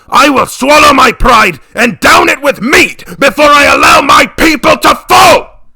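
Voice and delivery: building to a loud, high shout; furious tirade. Acted. Father berates his daughter, building to a shout. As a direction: rage at the daughter, climbing to a roar.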